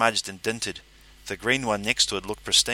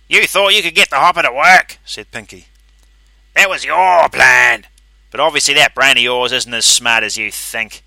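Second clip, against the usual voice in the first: angry taunt; 4 semitones higher, 12 dB louder than usual. A narrator's reading. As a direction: sneer an accusation. Pinky taunts.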